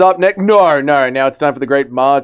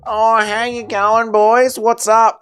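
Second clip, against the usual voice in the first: higher, drawn-out North Aussie accent, mocking; 5 semitones higher, slower than usual. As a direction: parody a North Queensland bloke greeting the boys. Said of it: "even the North Aussie accent alone".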